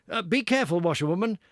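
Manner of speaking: calling out a loud warning from the back seat. Acted. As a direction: call out to stop her. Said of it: "call out warningly".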